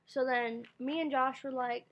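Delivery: ordinary talk, nothing marked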